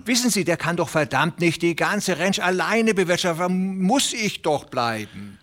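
high, fast, fretful little tenant pleading; a nervous little farmhand begging not to lose his place; Hooven the anxious tenant. Acted. Little tenant farmer pleading with Presley, high and anxious.

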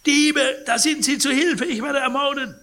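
high, loud panicked cry for help; terrified shriek for help; piercing scream. Acted. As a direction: scream it out of a nightmare. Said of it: "stieß einen durchdringenden Schrei aus".

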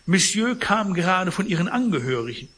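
high, loud fussy protest; concierge voice. Acted. Concierge protests to Pons, loud and fussy. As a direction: act the flustered concierge.

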